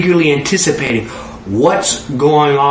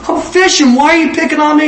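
whiny Peter; 12 semitones higher than usual. Acted. Peter complains to Jesus about being picked on, high whine.

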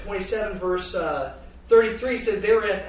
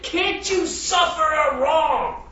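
higher, slow and loud, pleading; slow plea in God's voice to his kids; pleading rebuke; 8 semitones higher, 5 dB louder, much slower than usual. Acted. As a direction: drag it out as a heartfelt rebuke. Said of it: "It says".

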